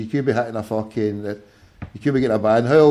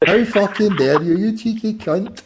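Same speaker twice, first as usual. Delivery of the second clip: mock outrage; 5 semitones higher, 5 dB louder than usual. Mock outrage at music insult.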